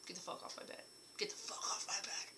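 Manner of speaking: hushed, annoyed whisper; whispered scolding. Retold or imitated. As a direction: hiss it at the dog half-asleep. Telling the dog off.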